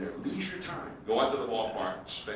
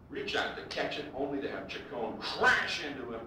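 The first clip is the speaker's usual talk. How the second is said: normal talking voice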